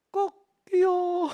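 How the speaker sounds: high, very slow, drawn-out call; voiced Jesus line. Jesus catching Peter's eye, slow high call. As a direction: stretch it out high and tender.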